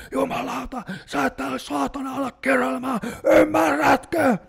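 high furious scream at a pupil; furious yelling teacher. Retold or imitated. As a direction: bellow it at full throat, losing it. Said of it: "huutaa ihan täyttä kurkkua". (opettaja huutaa täyttä kurkkua raivoissaan)

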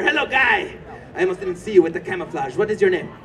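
usual speaking voice

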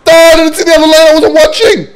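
loud high dismayed cry; dismayed shout. Real, not acted. Dismay at missed lane.

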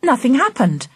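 ordinary delivery, nothing marked

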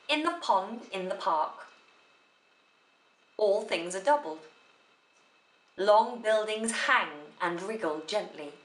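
long stops after "park" and "doubled"; separate sense groups. Shown as a demonstration. Teacher giving an example of phrasing, separate sense groups. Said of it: "What is a sense group".

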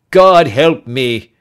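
loud, slow, despairing cry; loud, drawn-out plea for help; anguished cry. Acted. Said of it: "he cried".

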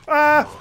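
short high, loud yelp; sudden high cry; alarmed scream. Real, not acted. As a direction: yelp as danger rushes in. Fast enemies appear.

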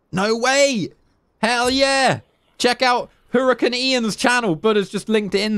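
high thrilled shout-out; excited. Real, not acted. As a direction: hype up his channel.

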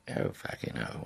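a hammed-up posh gent saying it casually; mock upper-class swear; posh accent. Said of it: "really posh".

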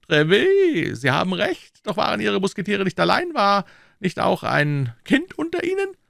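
very high-pitched king's voice; eager king. Acted. King asking Treville about his musketeers, eager high voice. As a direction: question your captain in a high, lifted royal voice.

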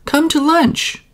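high friendly invite; a boatwoman calling her to lunch; high, inviting call. Acted. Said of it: "she called".

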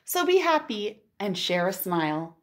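ordinary talk, nothing marked

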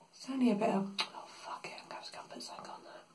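fast, hushed aside; whispering. Real, not acted. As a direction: whisper it quickly to yourself. Mutters while doing makeup.

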